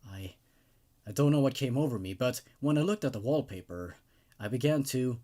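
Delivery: a touch hushed, even horror narration; creepypasta narration. A narrator's reading. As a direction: read it like a creepy tale told late at night. Reads the creepypasta story aloud.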